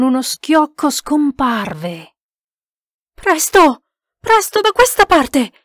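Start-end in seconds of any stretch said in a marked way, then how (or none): 3.1-5.5 loud, high urgent call; frantic shouted order; yelling. A narrator's reading. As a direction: yell to hurry them this way. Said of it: "urlava Harvey Deacon".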